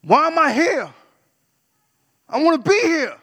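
furious high-pitched shout; mad. Retold or imitated. Waking from the coma after an overdose, mad shouting. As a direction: yell it out in outrage, loud and high. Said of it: "And I'm mad".

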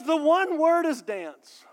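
slow, big fake announcement; mock grand reveal.

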